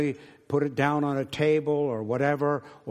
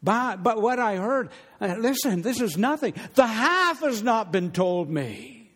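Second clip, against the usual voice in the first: amazed queen; 9 semitones higher, 3 dB louder than usual. Acted. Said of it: "and she said".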